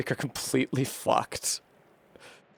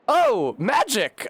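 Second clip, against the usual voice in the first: oh, magic, much higher and louder, drawn out; delighted surprise; 11 semitones higher, 5 dB louder, much slower than usual. Real, not acted. Gamer spotting a readable Greek word, delighted high exclaim. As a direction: burst out as if you found treasure.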